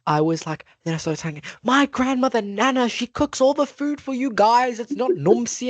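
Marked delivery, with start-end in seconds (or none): 1.6-5.7 high, emphatic retold protest; insistent child. Child telling everyone who cooks, high and insistent. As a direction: set them straight, higher-pitched and insistent.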